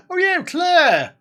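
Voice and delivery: high, loud cheer with drawn-out words; excited announcement. Real, not acted. Host announces good news for a viewer on stream, excitedly. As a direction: sing out the good news, stretching the name.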